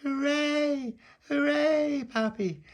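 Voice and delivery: mammy cheering with delight; excited cheer. Acted. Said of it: "And she went".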